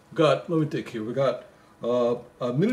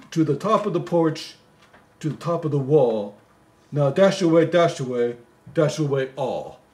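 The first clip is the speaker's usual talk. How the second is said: poem recital; 3 semitones higher, 5 dB louder than usual. Acted. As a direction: read it like a bedtime Christmas poem. Reading the poem aloud.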